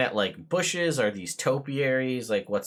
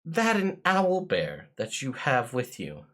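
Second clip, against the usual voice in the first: lightly voiced servant, a touch higher; careful, deferential questions; polite footman; 10 semitones higher than usual. Footman offering to take the guests' horses, politely hesitant. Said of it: "and be like".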